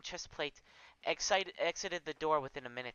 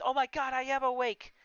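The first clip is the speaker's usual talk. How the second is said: high, loud horrified cry; 9 semitones higher, 5 dB louder than usual. Acted. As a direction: panic as it sinks in. Horrified realising he is awake.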